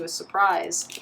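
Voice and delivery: usual speaking voice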